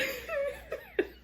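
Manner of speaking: high, squealing laugh that cracks up; real laughter at her own story. Real, not acted. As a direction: burst into a high helpless laugh.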